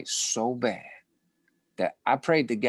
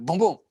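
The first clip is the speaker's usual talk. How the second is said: punch sounds; 7 semitones higher, 4 dB louder than usual. Retold or imitated. Mimics the punches landing.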